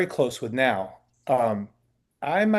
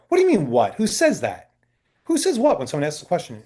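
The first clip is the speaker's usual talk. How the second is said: retold scolding, higher-pitched; indignant challenge; 4 semitones higher, 3 dB louder than usual. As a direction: call him out on his rudeness. Challenges the actor's rude reply.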